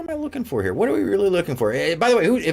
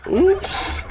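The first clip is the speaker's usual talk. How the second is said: squeaky oops. Acted. Mock reaction to cat.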